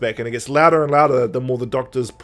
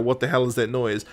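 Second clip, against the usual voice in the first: low, softer mock grumble; retold complaint; 4 semitones lower, 7 dB quieter than usual. Retelling his complaint about the noise, low muted grumble. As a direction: complain under your breath, lightly acted. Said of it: "he's complaining about it".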